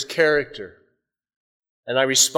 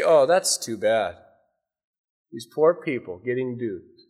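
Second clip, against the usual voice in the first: indifferent shrug; 4 semitones lower, 3 dB quieter than usual. Retold or imitated. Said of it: "he didn't just say".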